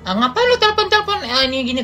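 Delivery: high mock-snippy voice of the friend, rude; imitating a snippy retort. The friend picking up the phone, mock snippy. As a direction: imitate the friend snapping back rudely. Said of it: "dia kan kayak nyolot gitu ya".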